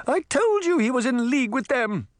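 high-pitched outcry from a queen; indignant cry. A narrator's reading. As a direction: cry out an angry accusation. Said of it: "the Queen cried".

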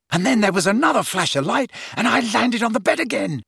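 high, delighted storytelling; gleeful retelling. Acted. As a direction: grin through the punchline. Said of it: "Ron grinned".

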